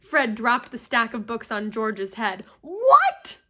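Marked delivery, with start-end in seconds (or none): 2.6-3.4 high, loud disbelieving yelp; shocked what. A narrator's reading. Fred hearing Percy has a girlfriend, loud shocked yelp. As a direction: blurt it out in total disbelief.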